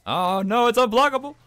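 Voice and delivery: high loud alarmed cry; panicked yell. Real, not acted. Player panicking at an unblockable move. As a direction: yelp in alarm.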